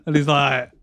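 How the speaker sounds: ordinary talk, nothing marked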